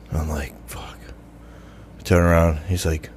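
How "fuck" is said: a very soft dismayed curse under the breath; muttered curse. Retold or imitated. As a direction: barely voice it, caught out. Retold dread at being recognized.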